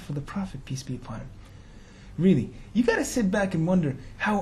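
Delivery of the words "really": usual speaking voice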